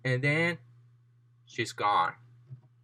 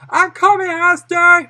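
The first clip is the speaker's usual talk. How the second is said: high, loud rallying cry; heroic shout; 14 semitones higher, 12 dB louder than usual. Acted. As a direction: call out loudly to the rescue. Hero calling out to the victim.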